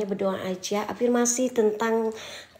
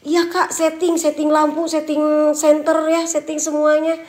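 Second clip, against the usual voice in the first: raised, louder sarcastic comeback; loud retort; 5 semitones higher, 6 dB louder than usual. Real, not acted. Streamer answering viewers who call it staged, loud retort. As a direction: throw the accusation back loudly. Snaps back at viewers claiming staging.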